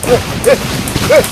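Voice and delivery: sudden high, loud startled cry. Real, not acted. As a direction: jump at a noise and blurt it out. Startled by something.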